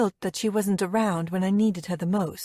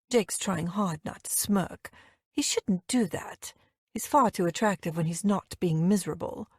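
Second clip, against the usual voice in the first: plain reading voice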